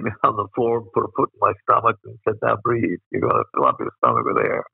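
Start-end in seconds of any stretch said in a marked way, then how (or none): 2.4-4.6 cranky voice teacher. Retold or imitated. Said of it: "She was a real cranky voice teacher".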